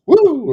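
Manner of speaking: loud, high whoop as an arm jerks; mock whoop. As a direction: yelp like a limb jumping on its own. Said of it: "my arm would go".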